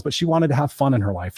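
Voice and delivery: normal talking voice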